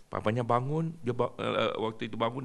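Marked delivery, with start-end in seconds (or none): none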